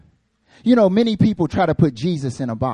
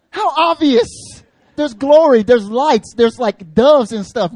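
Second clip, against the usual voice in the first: much higher and louder mock disbelief; sarcastic exasperation; 8 semitones higher, 9 dB louder, slower than usual. Pastor mocking Peter's remark, loud sarcastic disbelief. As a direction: roll your eyes at the obvious.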